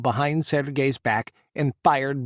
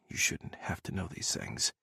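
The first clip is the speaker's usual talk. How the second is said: a soft whisper, much softer; 8 semitones lower, 12 dB quieter than usual. A narrator's reading. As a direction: breathe it out, almost voiceless. Ethan speaks softly, frowning.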